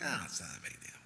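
soft, low, breathy shrug it off; dismissive whisper. Retold or imitated. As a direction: hush it like a secret excuse. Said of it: "we're thinking like".

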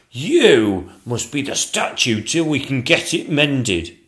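a foreman giving orders firmly; commanding chief painter. Acted.

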